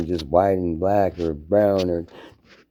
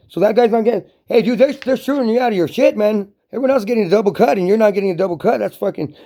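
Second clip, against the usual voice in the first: heated indignation; 14 semitones higher, 5 dB louder than usual. Real, not acted. Heated retelling of the unfair cut.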